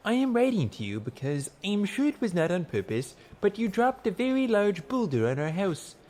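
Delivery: high-pitched, politely pleading letter voice; earnest petitioner's letter. A narrator's reading. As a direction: read it as a humble formal petition. Narrator reads a petitioner's letter aloud.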